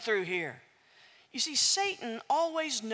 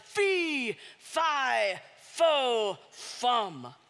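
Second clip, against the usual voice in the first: lightly voiced giant calling out each word; giant shouting; much slower than usual. Giant in his castle, a light mock bellow. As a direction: boom it out like a giant on the stairs. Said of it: "he shouted".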